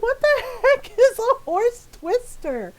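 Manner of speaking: high-pitched, laughing incredulous question; baffled disbelief. Retold or imitated. Retold baffled reaction to mom.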